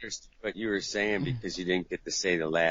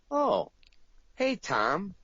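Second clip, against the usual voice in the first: much higher chirpy voice; mock cheery caller; 11 semitones higher, slower than usual. Guy leaving Tom a voicemail, chirpy before cut. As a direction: sound friendly before the tape cuts.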